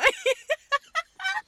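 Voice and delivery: high, loud laughing fit; real laughter breaking out; burst of laughter. Real, not acted. Laughing at her own story. (lautes Loslachen)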